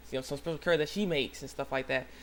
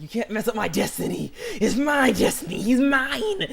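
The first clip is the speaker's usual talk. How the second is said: high, loud, hammed-up possessive outburst; mock mad girl; 8 semitones higher, 7 dB louder, slower than usual. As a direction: build to an over-the-top jealous cry. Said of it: "she's getting mad".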